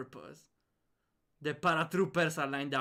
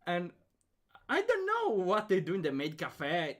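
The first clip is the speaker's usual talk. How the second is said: normal talking voice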